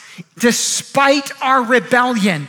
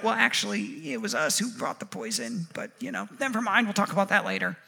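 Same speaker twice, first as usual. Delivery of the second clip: low, much softer admission; sheepish aside; 5 semitones lower, 13 dB quieter, faster than usual. Acted. People admit they brought the poison, sheepish aside. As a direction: trail away quietly, acted reluctance.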